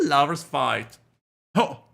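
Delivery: normal talking voice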